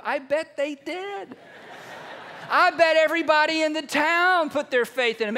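high, rising into loud conviction; excited emphasis. Real, not acted. Preacher sure the whole town believed after Lazarus rose, excited. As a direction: exclaim it with mounting volume.

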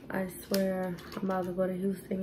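normal talking voice

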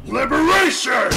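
very loud, high attack yell into a laugh; attack shout. Acted. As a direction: scream it, then cackle. Villain unleashes attack, laughing.

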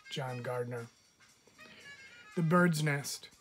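ordinary delivery, nothing marked

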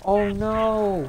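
loud startled cry; sudden panic; alarmed shout. Real, not acted. Sudden danger during gameplay.

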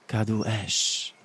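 slow, drawn-out fire spell. Acted. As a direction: draw out Kadu Esz slowly. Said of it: "ponownie wypowiedziała zaklęcie ognia".

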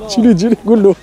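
usual speaking voice

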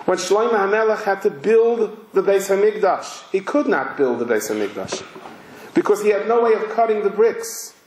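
normal talking voice

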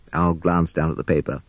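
plain reading voice

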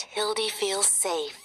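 plain reading voice

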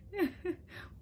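light high giggle; quick amused burst of laughter; soft real giggle; short laugh. Real, not acted. Laughs at dog.

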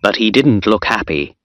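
plain reading voice

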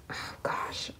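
hushed, whispered delight; awed whisper. Real, not acted. Fan seeing the fern page, whispering in awe. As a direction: whisper as if too taken to speak aloud.

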